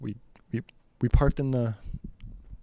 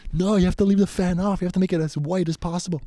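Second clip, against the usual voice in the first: high, louder, annoyed outburst; 9 semitones higher, 5 dB louder, faster than usual. Retold or imitated. Scolding a friend for turning on the fan, annoyed. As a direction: reenact an exasperated complaint in a raised pitch. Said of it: "I'd get, like, annoyed".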